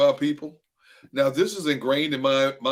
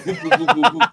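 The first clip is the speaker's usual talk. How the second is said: high, loud whooping pulse sounds; imitated racing heartbeat; 8 semitones higher, 7 dB louder than usual. Telling how espresso set his heart racing, high loud whoops. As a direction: make your heart pound out loud.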